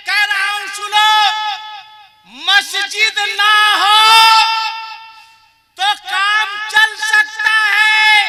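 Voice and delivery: loud, slow, rising preacher's cry to the crowd; fiery preaching. Acted. Cleric thundering at the jalsa crowd, full-force preaching. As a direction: thunder each clause at the congregation.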